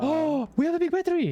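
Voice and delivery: high, gasping discovery; excited gasp. Real, not acted. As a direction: gasp with delight at a find. Gasps at a find.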